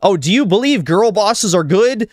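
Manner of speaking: high-pitched, heated taunt; mocking challenge. Ranting at people who claim they can't define woke, mocking. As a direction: throw it at them as a scornful gotcha. Mock argument thrown at imagined opponents.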